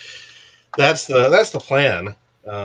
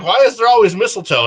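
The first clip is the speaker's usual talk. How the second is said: higher, louder retold protest; mock exasperation; 9 semitones higher, 6 dB louder than usual. Retelling Spider-Woman's complaint, higher mock protest. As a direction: raise pitch and volume for her complaint. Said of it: "she's like".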